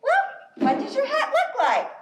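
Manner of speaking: high-pitched deer voice. Acted. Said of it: "And Deer says".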